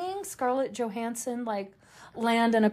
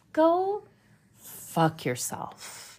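high "Go", then a very slow, dropped whisper on the rest; mock retort; 5 semitones higher, much slower than usual. Retelling her silent reaction to fans, mock snarl. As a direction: let the insult sink into a hiss. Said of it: "I was like".

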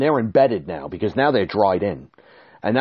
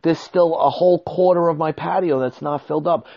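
high, louder protest; exasperated; 9 semitones higher, 5 dB louder than usual. Retold or imitated. Telling the contractor the patio isn't filled, high loud protest. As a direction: push back hard. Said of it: "I said".

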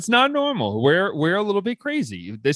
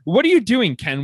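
louder, higher, mock scolding; exasperated outburst; 4 semitones higher, 4 dB louder than usual. Real, not acted. Fan scolding the reporter for a false tweet, exasperated outburst. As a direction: call out the reporter in disbelief.

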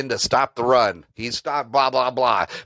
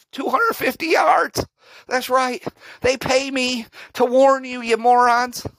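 Jim Knowles impression; 8 semitones higher than usual. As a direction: ham up a smug coach bragging his warning. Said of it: "my best impression of Jim Knowles".

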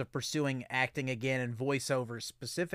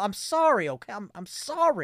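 high, loud exaggerated apology; angry apology; 5 semitones higher, 4 dB louder than usual. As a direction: apologize in a hammed-up panic. Mock apology to scolding host.

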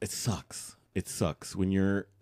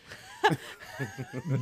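airy high laugh; 3 dB quieter than usual. Real, not acted. Laughing at her own joke.

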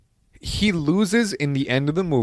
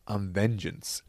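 low, soft menacing Batman voice; quiet mock Batman menace; Batman growl; 4 semitones lower, 8 dB quieter, slower than usual. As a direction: say it like the dark hero. Batman quote in retold scene.

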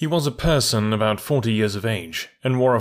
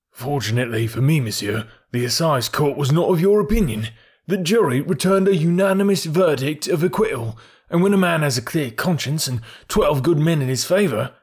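forced bravado; 6 semitones higher, 3 dB louder than usual. A narrator's reading. False-confident speech after false smile.

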